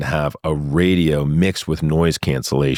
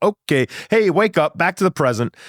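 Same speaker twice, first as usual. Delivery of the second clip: snapping out of it; 12 semitones higher than usual. Retold or imitated. Snapping himself back to present.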